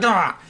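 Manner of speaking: short, mock startled yell; brief acted "Ah!" of alarm; startled cry. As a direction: yelp as if caught off guard. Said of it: "so he's like".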